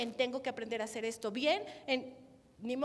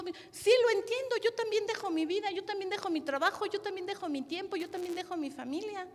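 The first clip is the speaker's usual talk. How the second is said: forceful preaching reply; 7 semitones higher, 4 dB louder than usual. Acted. Responde con fuerza predicando.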